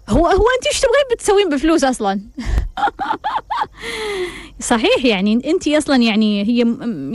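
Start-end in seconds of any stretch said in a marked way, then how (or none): 0.1-2.2 mocking disbelief. Real, not acted. Mocking the wife's question. 2.3-4.4 a quick high laugh at her own jab; amused laugh breaking out. Real, not acted.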